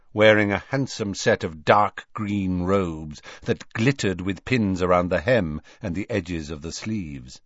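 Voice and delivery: plain reading voice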